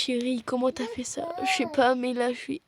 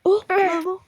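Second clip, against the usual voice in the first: urgent cry; 5 semitones higher, 8 dB louder than usual. Pretend. Kid in pretend play cries out.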